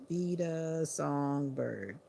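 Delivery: slow spelled-out. Shown as a demonstration. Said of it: "no spaces".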